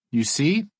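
loud, high, overdone cheer; hammed-up delight to fool listeners; fake enthusiasm. A narrator's reading. Friend covering for Kat at the lodge, fake enthusiasm. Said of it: "with as much fake enthusiasm".

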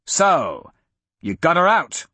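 a contained villain's bellow; Braga, bellowing. A narrator's reading. Braga confronting Hadrian in the hall, mildly bellowing. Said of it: "Braga bellowed".

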